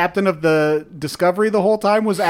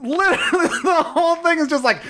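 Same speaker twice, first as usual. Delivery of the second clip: high-pitched, laughing through the words; cracking up while trying to explain; real laughter breaking into the talk; 11 semitones higher than usual. Real, not acted. Cracking up at the show's apology.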